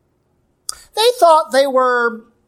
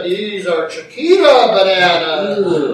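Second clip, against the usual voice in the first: slow, loud mock announcer; game show announcer; 2 semitones lower, 3 dB louder, slower than usual. Announcer naming the booby prize, mock announcer voice. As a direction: announce the prize with drawn-out showmanship. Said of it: "the announcer was always quick to tell you".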